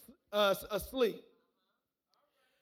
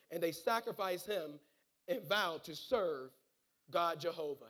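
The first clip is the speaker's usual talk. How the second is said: low, slowing reading voice; hushed close of the Bible story; scripture reading; 5 semitones lower than usual. A narrator's reading. Reads story's ending.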